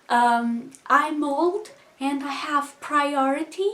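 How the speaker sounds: high, loud, slow mock rage at the bus stop; hammed-up furious old lady snapping; really mad. Old lady pushing in at the bus stop, mock furious. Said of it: "really mad".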